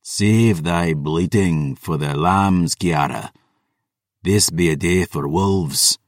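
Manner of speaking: put-on a scornful old count putting his daughter down; dismissive vampire father, very slow; cold vampire lord. Nikita's voice in the story.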